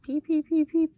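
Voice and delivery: high, loud little peeps; chick peeping. A narrator's reading. Girls peep in the dark like chicks. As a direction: chirp like a baby chick.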